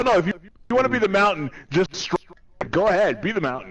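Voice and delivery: high, loud, rapid retort; worked-up banter, pitched high; teasing challenge. Real, not acted. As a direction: dare them to go ahead. Mock-challenging a boastful friend.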